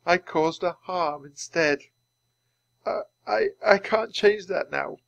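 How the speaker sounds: high-pitched, tearful confession; weeping. Acted. Teddy blames himself for harming Penny, weeping. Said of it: "As Teddy wept".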